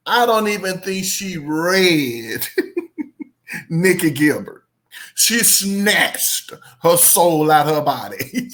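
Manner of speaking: slow, laughing retelling; amused, with laughs between phrases. Real, not acted. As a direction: draw it out, breaking into laughter. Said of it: "it's not funny".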